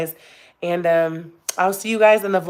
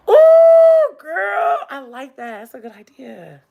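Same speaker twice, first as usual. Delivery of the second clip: sudden thrilled whoop that fades; excited squeal; 9 semitones higher, 8 dB louder, slower than usual. Real, not acted. Excited reaction to idea.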